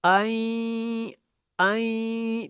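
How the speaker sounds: sustained nasal ان; ghunna demo. Shown as a demonstration. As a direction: hum the n of ان firmly and steadily. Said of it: "غنہ کے ساتھ ادا کیا".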